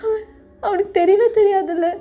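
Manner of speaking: crying. Acted. Pregnant sister longs for her husband's hand, sobbing. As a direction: cry through each broken phrase.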